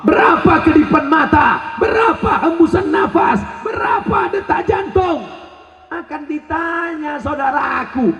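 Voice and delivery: high, impassioned run of questions; fervent preacher's cry, pitched high; forceful preaching. Real, not acted.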